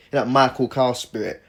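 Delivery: ordinary talk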